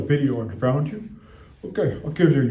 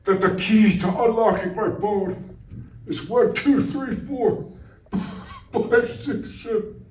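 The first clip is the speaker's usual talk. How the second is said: mock dying secret; 8 semitones higher, slower than usual. Dying castaway's last secret.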